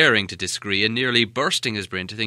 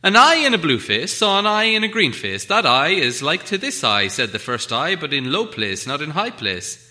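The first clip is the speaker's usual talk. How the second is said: riddle recital; 5 semitones higher, 7 dB louder than usual. Acted.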